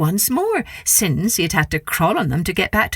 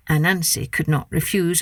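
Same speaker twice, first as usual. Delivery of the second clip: usual speaking voice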